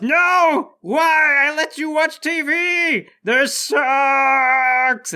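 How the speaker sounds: high wailing death cry; doctor yelling. Acted. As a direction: scream in drawn-out despair as you're grabbed. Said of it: "The doctor yells".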